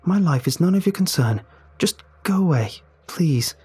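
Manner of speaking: hint of a hurt teen telling him to leave; lightly voiced upset schoolgirl. Ellie begging Sam to go away, mildly tearful.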